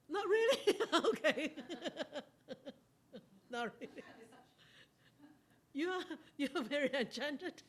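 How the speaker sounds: loud, high, laughing disbelief; amused teasing. Real, not acted. Teacher teasing enchanted students, laughing loudly. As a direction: tease them through your laughter.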